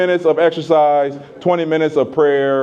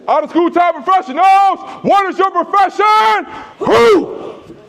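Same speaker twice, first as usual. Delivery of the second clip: much higher, louder chanted call; 3 dB louder than usual. Shown as a demonstration. Leader rallying the professionals, a loud shouted call. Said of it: "So I'm gonna use my loud voice".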